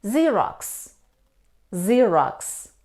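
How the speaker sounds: Xerox begun with z, "ZEER-oks". Shown as a demonstration. Teacher correcting learners, Xerox said with an initial z. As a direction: say Xerox with no k at the start. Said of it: "isn't pronounced in these words".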